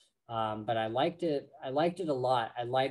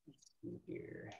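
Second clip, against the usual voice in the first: barely audible, voiceless mumble; muttering to self; 16 dB quieter than usual. Real, not acted. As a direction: mutter it under your breath while looking. Muttering to self checking notes.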